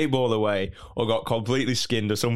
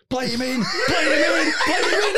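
much higher, louder laughing yell; shouting at match; 5 dB louder than usual. Retold or imitated. Liam urging a pass among Lazio fans, laughing shout. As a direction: bellow it like a fan urging the pass.

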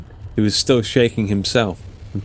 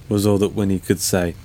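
plain reading voice

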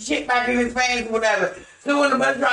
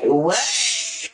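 a low, mock-puzzled "What?"; clueless guy's what; 4 semitones lower than usual. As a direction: play dumb, dropping the voice low. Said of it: "he said it just like that".

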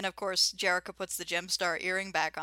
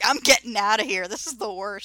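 loud, mock-panicked bear bailing out; a bear loudly wanting out; fed-up scared bear; 3 semitones higher, 7 dB louder than usual. Host voices the bear fleeing the giggling goblin, mock panic.

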